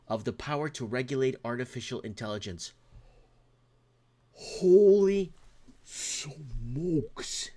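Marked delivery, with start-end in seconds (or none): 4.3-7.5 drawn-out exclamation, high and loud at first; astonished, with a pause between the words; stunned amazement. Real, not acted. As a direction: stretch each word out in amazement. Stunned reaction to major news.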